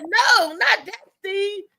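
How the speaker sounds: a hint of Molly setting the record straight; imitating Molly. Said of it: "Molly said".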